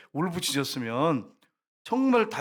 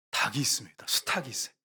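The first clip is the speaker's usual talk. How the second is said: secretive whisper; 4 semitones lower than usual. Real, not acted. Revealing the rooster symbol.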